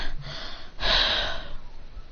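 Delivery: soft, breathy exhausted sigh; defeated breath. Acted. John falls to the ground defeated, spent breath. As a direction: let the breath go, acting beaten. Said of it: "beaten and defeated".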